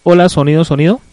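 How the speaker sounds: very loud, level mic check; prueba de micrófono. Real, not acted. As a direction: test the mic loudly, rising at the end. Probando el sonido de la emisora. (prueba de sonido en voz muy alta)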